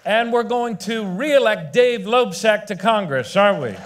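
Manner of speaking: high, loud campaign crescendo; rally shout. Real, not acted. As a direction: build the crowd to a roar. Rally crescendo naming candidates.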